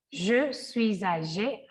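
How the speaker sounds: je suis âgé slowly, the S joined to âgé as Z; liaison demo. Shown as a demonstration. Teacher showing the liaison, slowly. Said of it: "aquí hacemos la liaison".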